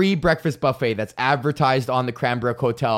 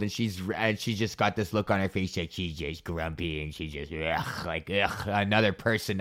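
grumpy; 7 semitones lower, 9 dB quieter than usual. Retold or imitated. Describing grumpy old clerk.

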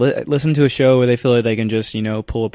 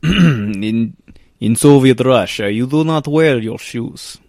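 hammed-up Russian accent, slow and deliberate; mock Russian accent; 6 dB louder, slower than usual. Co-host answers with his own Russian accent joke. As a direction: do a Russian accent that slips toward Ukrainian. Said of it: "sounds more Ukrainian than Russian".

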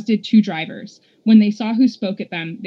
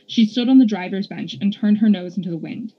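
plain reading voice

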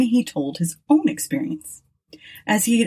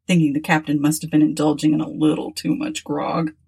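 usual speaking voice

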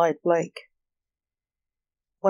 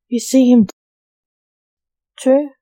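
louder, higher, pointed question; triumphant; 4 semitones higher, 7 dB louder than usual. A narrator's reading. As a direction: exclaim it in triumph, breaking before the last word. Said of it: "Val said triumphantly".

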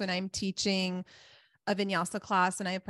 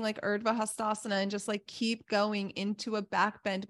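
ordinary talk

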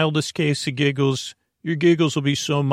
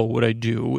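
usual speaking voice

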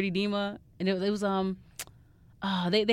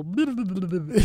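imitated cartoon goblin gibberish; rapid nonsense babble as little goblins; playful mouth-noise imitation. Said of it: "they would go".

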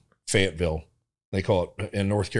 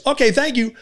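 dismissive sign-off; 6 dB louder than usual. Retold or imitated. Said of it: "finally he goes".

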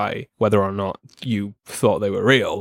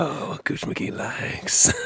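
very slow, soft whispered rasp; Gooch McGee; 4 semitones higher, 5 dB quieter, much slower than usual. Acted. Halfling speaking of himself in third person, slow whisper. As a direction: draw it out in a husky whisper. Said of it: "He only refers to himself in third person".

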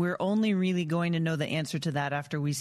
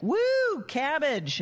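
mock Irish cheer; 10 semitones higher, slower than usual. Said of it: "Irish, we're like".

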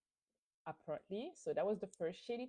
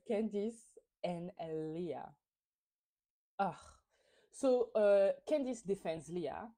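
ordinary delivery

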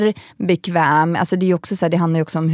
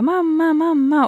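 put-on high-pitched little boy calling mamma; imitating a small child spotting mum; excited child; 10 semitones higher, slower than usual. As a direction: call out like an eager toddler. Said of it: "Zion och bara".

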